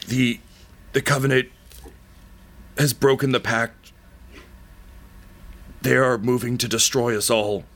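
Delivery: dying man warns the queen of the Covenant; dying warning. Acted. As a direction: spend your last breath on the warning.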